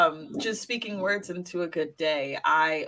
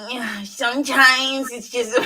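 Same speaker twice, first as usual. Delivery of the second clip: high, slow character voice, half-managed; Ghost of High Heart; 7 semitones higher, 5 dB louder, slower than usual. Acted. Host attempts requested character for friends, Ghost of High Heart voice. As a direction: try a drawn-out, higher put-on voice. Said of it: "Do it in the ghost of high heart voice".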